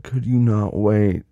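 slow, loud repeated question. Acted. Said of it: "I said".

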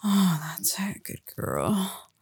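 ordinary delivery

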